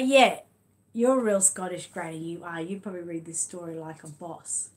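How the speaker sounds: ordinary delivery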